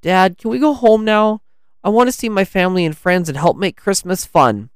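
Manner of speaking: put-on high-pitched eager kid voice; pleading child. A narrator's reading. Lance asks dad to go home, pleading kid. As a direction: ask up high like an earnest child.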